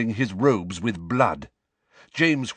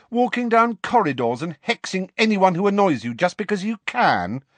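high, heated rant; angry tirade; 10 semitones higher than usual. A narrator's reading. Lily lists James's faults to his face, angry. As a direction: pile up the accusations without letting up.

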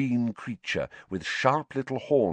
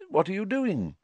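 high, friendly inquiry; lifted, unsuspicious greeting; unlike the snarl; 12 semitones higher than usual. A narrator's reading. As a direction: ask with mild, kindly curiosity. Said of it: "in a very different voice from Filch".

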